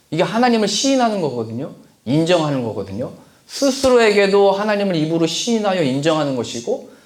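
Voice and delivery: ordinary talk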